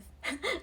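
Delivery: a short high scoffing laugh; mocking laugh. Pharaoh scoffing at Aaron's snake, short high laugh. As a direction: laugh him off, high and amused.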